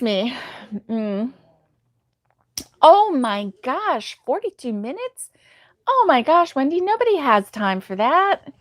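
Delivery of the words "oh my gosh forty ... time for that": high-pitched, playful disbelief; incredulous. Real, not acted. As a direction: react aghast to absurd news, pitch jumping up. Reacting to a viewer's very long ad.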